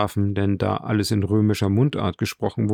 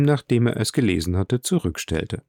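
plain reading voice

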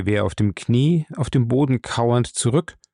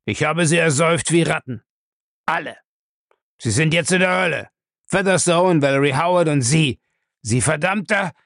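high, heated triumph; gloating outburst; 6 semitones higher than usual. A narrator's reading. Bellamy telling the archer he drowned them, gloating heatedly. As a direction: gloat vehemently, pitch held high.

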